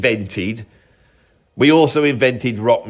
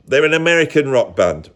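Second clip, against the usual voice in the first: normal talking voice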